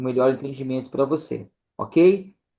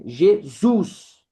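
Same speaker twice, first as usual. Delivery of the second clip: "Jesus" with the last syllable stretched; stretched final syllable; 3 semitones higher, 3 dB louder than usual. Shown as a demonstration. Teacher showing students the stressed syllable, final stretched. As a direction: split "Jesus" and lean long on "sus". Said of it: "ele deu uma esticada".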